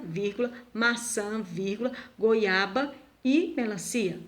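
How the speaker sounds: slow, pausing list on maçã and goiaba; slow list with pauses. Shown as a demonstration. Teacher slowly reads a fruit list, pausing at each comma. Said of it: "A vírgula é utilizado para representar uma pausa".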